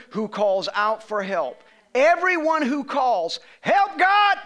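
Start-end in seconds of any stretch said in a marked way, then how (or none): 1.9-3.4 slow, high, loud preached verse, every word leaned on; preaching at full force. Acted. Pastor pressing the verse on his church, preaching loud. 3.6-4.4 high, loud desperate plea; cry for help. Acted. Someone in trouble calling on God, high loud plea.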